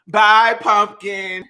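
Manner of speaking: "Bye" stretched very slowly. Pretend. Saying goodbye to the pumpkin, very slow. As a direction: draw "Bye, pumpkin" out even longer.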